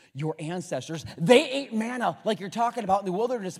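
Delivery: normal talking voice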